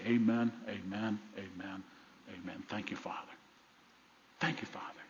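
low, very soft, fading away; hushed prayer. Real, not acted. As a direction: murmur it privately to God. Preacher turns to thank God in prayer.

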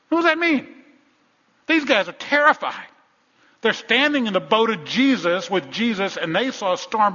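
high, loud sermon outburst; full-force preaching. Real, not acted. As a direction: burst out at the congregation, loud and high.

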